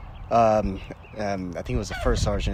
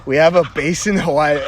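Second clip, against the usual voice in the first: loud, higher-pitched disbelief; astonished outburst; 5 semitones higher, 9 dB louder than usual. Retold or imitated. Young Marine breaking rank before his first sergeant, astonished outburst. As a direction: blurt it out in amazed excitement. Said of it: "I just broke rank and I said".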